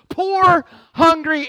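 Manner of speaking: shouted preaching emphasis; emphatic shout. Real, not acted. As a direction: punch out each item of the list. Emphatic list mocking the blessed life.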